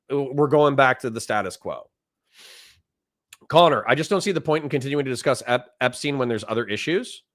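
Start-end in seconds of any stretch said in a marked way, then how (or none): none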